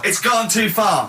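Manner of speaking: slow, high cry of outrage; exasperated protest. Real, not acted. Co-host protesting as his microphone is cut, exasperated.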